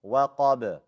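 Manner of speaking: وَقَبَ ending in a small bounced b; qalqala on ba. Shown as a demonstration. Teacher demonstrates the small bounce, qalqala in وَقَبَ. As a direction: release the final ba of وَقَبَ with a tiny echo vowel. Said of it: "do qalqala on the ba".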